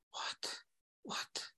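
breathy whispered What, almost no voice; whispered disbelief. Retold or imitated. Retelling his delayed shock, he whispers in disbelief. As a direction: whisper it to yourself, stunned. Stunned realisation after witnessing shooting.

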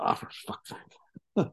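slow whispered exasperation under the breath; slow exasperated whisper to yourself; muttered aside. Retold or imitated. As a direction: breathe it out slowly like a private groan. Inner groan at sober partner.

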